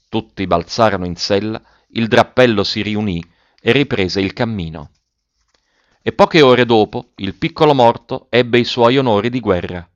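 ordinary delivery, nothing marked

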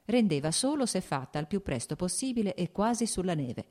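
usual speaking voice

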